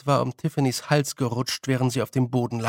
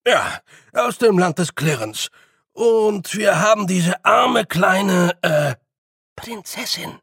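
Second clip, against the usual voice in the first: higher, faltering little fairy making it up; hesitant Feegle; 6 semitones higher, 5 dB louder than usual. A narrator's reading. Billy inventing a story for the old woman, higher hesitant Feegle. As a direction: stumble toward the word princess.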